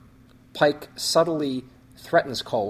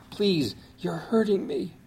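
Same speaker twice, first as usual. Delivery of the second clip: lightly voiced weak plea, higher; 8 semitones higher than usual. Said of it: "No, it was more like".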